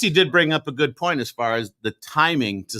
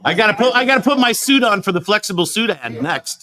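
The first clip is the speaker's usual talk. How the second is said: hurried model; 10 semitones higher, 4 dB louder than usual. Pretend. Voicing the model in the ad.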